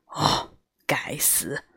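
low, quiet, slow grumble under the breath; grumbling mutter. A narrator's reading. Tiger mutters in annoyance as the girl chases him, low grumble. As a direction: mutter it low and grudging to yourself. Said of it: "老虎嘟嘟囔囔地说".

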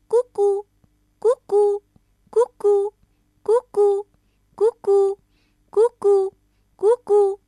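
high, loud cuckoo calls; cuckoo clock call. Acted. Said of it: "墙上的杜鹃挂钟响了起来".

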